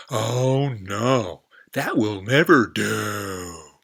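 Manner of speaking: loud, slow dismissive frog voice; rude frog refusal. Acted. Frog rudely rejecting the giraffe, loud and slow. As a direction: brush her off rudely. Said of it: "rather rudely".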